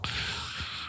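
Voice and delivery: whispered sigh. Acted. Wolf sighs in whisper.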